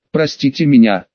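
ordinary delivery

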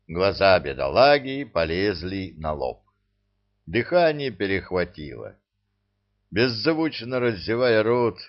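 ordinary delivery, nothing marked

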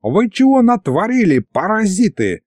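put-on high, furious old-man scolding; angry old man. A narrator's reading. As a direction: tear into them like an outraged grandad. Grandad scolding the knights.

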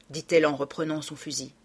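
ordinary delivery

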